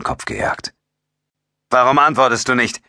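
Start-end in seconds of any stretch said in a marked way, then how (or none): 1.7-2.8 high, raised demand; calling out. A narrator's reading. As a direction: demand an answer, voice up. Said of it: "rief Ryan".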